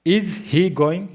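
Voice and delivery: very slow, every word stretched; teacher's drawn-out example; slow demo. Shown as a demonstration. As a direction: drill it slowly for learners. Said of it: "अभी आप इधर देखिए".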